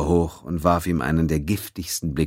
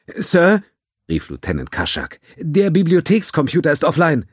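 panicked; 11 semitones higher, 5 dB louder than usual. A narrator's reading. Panicked technician reporting.

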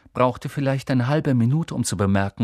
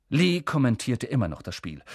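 plain reading voice

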